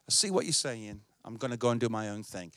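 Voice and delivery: low, soft, brushing-off voice; dismissive believer. Retold or imitated. Pastor playing a believer ignoring counsel, low dismissive. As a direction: shrug the advice off quietly.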